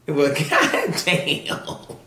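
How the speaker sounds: very slow, laughing "well goddamn"; finding the mess, a slow laughing groan. Retold or imitated. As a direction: drag it out, laughing. Retold shock.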